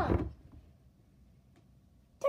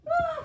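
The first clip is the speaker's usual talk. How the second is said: high, loud cartoon scream; 9 dB louder than usual. Puppet screaming while fighting, high and loud. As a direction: let out a high yelp.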